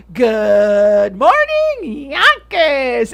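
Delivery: put-on very slow, drawn-out Yonkers accent greeting; mock Yonkers accent morning call. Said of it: "she has a very Yonkers, like, accent".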